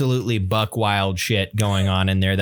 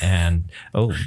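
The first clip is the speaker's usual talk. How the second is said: voice crack; 5 semitones lower than usual. Real, not acted. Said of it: "voice crack there".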